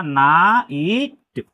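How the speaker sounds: नाइट broken into naa, ee, t; नाइट in long separate pieces, ending on a short t; sounded out slowly. Shown as a demonstration. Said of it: "तो क्या हो जाएगा".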